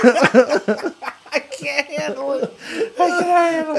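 high, squeaky words through laughter; laughing too hard to speak; helpless laughter. Real, not acted. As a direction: squeeze the words out while cracking up. Listener cracks up at the punchline.

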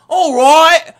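loud, high hammed-up British accent, slow on beans and toast; mock a cheesy British accent for a paid role. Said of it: "do an English or British accent".

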